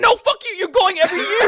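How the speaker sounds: high, loud, mock-offended; indignant shout. Real, not acted. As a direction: yell back, playfully outraged. Indignant reply to "really old.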